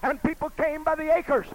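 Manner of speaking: from steady to loud, high preaching; building preaching. Acted. Preacher challenging the church, building to full preaching. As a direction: build it up to a shout.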